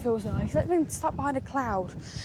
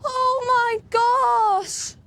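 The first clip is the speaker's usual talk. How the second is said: raised, high voice over the wind; shouting over wind; 8 semitones higher than usual. Real, not acted. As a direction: call out above the gusts. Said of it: "I don't think you can hear me over the wind".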